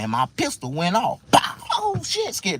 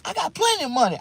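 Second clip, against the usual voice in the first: cocky high brag. Retold or imitated. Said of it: "I'm like".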